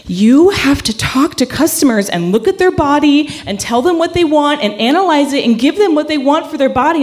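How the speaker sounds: mock the demanding boss; imitating a pushy boss. Boss Shelley lecturing staff.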